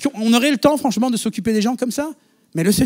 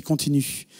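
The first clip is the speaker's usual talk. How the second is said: softer, God's single word said low; quiet divine word; 5 semitones lower, 7 dB quieter, slower than usual. Retold or imitated. God gently telling the student to keep going, softly. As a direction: hush it like God's quiet nudge. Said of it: "C'était Dieu qui a dit".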